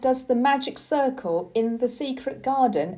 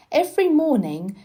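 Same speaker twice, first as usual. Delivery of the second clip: ordinary delivery, nothing marked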